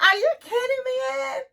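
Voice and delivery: high, squealing disbelief; mock outraged disbelief. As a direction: shriek it like she can't believe him. Liz outraged again.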